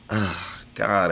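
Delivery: normal talking voice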